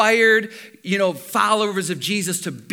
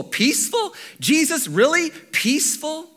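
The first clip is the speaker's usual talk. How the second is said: incredulous; 7 semitones higher than usual. Retold or imitated. Incredulous crowd reaction.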